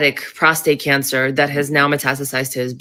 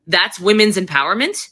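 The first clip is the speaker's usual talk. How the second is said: ordinary talk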